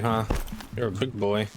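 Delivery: usual speaking voice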